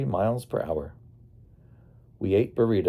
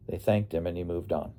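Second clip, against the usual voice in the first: usual speaking voice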